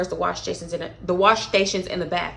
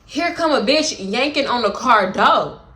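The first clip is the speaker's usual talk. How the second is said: ordinary delivery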